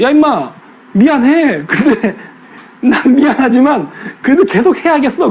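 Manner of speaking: sorry-not-sorry, laughing it off; laughing mock apology. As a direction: grin through a fake sorry. Park's cheeky apology, laughing.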